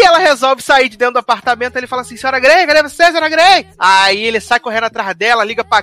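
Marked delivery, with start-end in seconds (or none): 2.2-3.7 higher-pitched acted call. Bodyguard searching for Mrs Grey, calling out. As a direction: call out for someone missing.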